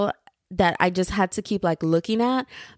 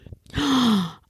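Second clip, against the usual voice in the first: sharp gasp; 4 semitones higher than usual. Real, not acted. Sudden realization.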